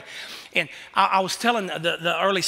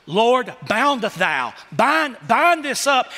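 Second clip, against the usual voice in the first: hammed-up hostess casting out evil; mock rebuking prayer; 3 semitones higher, 4 dB louder, much slower than usual. Hostess praying over the deviled eggs, slow mock prayer.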